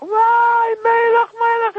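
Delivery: high, loud retold scream. Rabbi screams at himself over his sin, loud high scream. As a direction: scream his own name in anguish. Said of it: "לצרוח".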